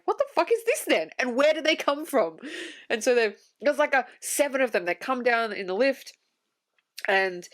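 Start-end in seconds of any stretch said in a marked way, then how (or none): 0.1-2.4 sudden high pitched what-the-hell; baffled outburst. Retold or imitated. Confused viewer reacting to soldiers appearing from nowhere, high and baffled. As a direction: jump up high in disbelief. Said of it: "And I'm like".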